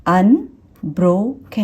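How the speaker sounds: अनब्रोकन slowly tapped out in three syllables. Shown as a demonstration. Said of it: "ये हो गया तीन syllable word".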